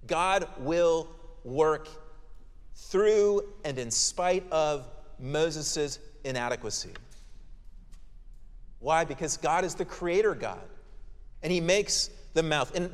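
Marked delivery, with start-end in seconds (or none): 0.1-6.9 slow emphasis. Real, not acted. Preacher stressing key point.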